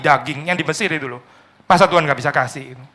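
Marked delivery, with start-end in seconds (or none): none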